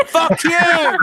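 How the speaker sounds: sudden loud outburst; angry shout. Real, not acted. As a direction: yell it in frustration. Tongue twister fails, frustrated.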